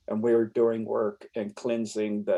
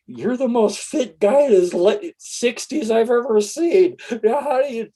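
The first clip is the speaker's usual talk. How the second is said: amazed driver; 11 semitones higher, 5 dB louder than usual. Retold or imitated. Driver marvelling at his fitness.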